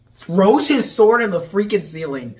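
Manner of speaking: ordinary delivery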